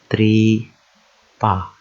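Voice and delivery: tripa split into TRI, pause, PA; syllable by syllable. Shown as a demonstration. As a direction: sound out tripa in two slow, separate beats. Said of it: "practice joining two syllables together".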